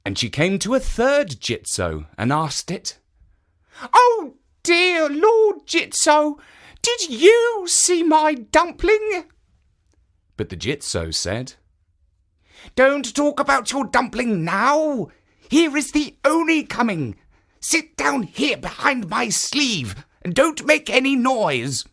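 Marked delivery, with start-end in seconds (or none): 3.9-9.2 put-on high, slow pleading old woman's voice; old woman asking. A narrator's reading. Old woman asks third statue. 12.8-21.8 high, hushed-up urgent warning; urgent statue. A narrator's reading. Statue hides the woman urgently.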